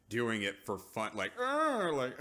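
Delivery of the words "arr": high, loud mock snarl; mock angry growl. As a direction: growl in pretend fury. Acting imagined cruel mother.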